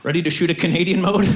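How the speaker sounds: ordinary delivery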